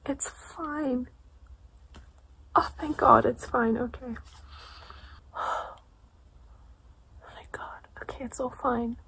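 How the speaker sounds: real relieved murmuring; relieved whisper. Real, not acted. Relieved, whispering while reading.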